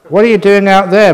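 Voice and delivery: loud, high indignant bluster; a touch of blustering outrage. A narrator's reading. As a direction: demand it, blustering. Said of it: "meditator blustered".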